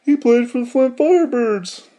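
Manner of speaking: high-pitched put-on fan voice; imitating a fan boasting, high throughout; bragging fan. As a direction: pitch it up like an excited longtime fan. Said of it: "You'll be like".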